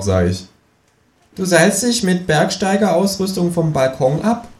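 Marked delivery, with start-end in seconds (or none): 1.3-4.5 a high, cheeky kangaroo asking. Acted. Kangaroo asks the narrator abseiling from the balcony, high and cheeky. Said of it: "Känguru speaks".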